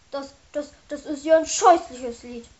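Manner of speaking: stammering. Acted. Ben reacts to the horrible song, stammering. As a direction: stumble over the first word, then blurt it. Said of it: "stammelte Ben".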